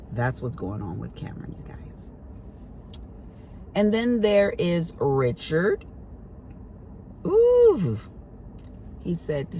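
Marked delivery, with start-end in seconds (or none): 0.1-2.1 hushed, unvoiced aside to the viewers; confiding whisper about her son. Real, not acted. 7.2-8.0 high, loud teasing ooh; a loaded ooh before the story; playful ooh about Richard; knowing ooh. Real, not acted. Reacting to next child's name.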